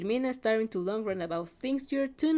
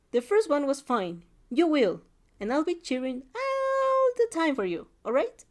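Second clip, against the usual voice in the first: higher, emphatic cheerleading friend; cheering friend; 6 semitones higher, 4 dB louder than usual. A narrator's reading. Friend promises to cheer all the time, emphatic encouragement. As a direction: promise support, swooping up on "all".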